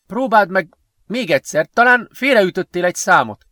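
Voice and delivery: acted urgency, pitched well up; urgent suggestion. A narrator's reading. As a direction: urge another try under pressure. Urgent suggestion while trapped.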